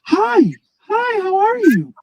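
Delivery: high, put-on woman voice. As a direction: pitch it up like a woman. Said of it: "let me actually try to talk like a woman".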